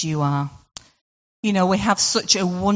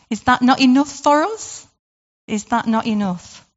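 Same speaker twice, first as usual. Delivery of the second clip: emphatic challenge; 6 semitones higher than usual. Real, not acted. Preacher pressing congregation.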